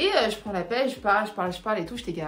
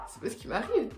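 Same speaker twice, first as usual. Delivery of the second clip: ordinary talk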